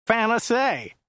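high, whiny chauffeur; Joe's whiny voice. A narrator's reading. Driver describing the client to his passenger, high and whiny. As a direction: pitch it up into a whine. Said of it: "His voice was rather high and whiny".

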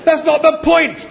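very high, exasperated outburst; loud protest. A narrator's reading. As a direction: protest shrilly, loud and at the top of the voice. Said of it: "Arthur protested".

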